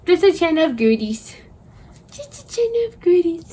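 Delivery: put-on very high, cooing baby talk to a dog; puppy voice. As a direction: coo like talking to a puppy. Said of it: "Sorry for my puppy voice".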